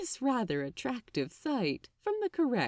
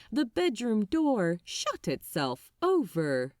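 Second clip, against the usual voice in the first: ordinary delivery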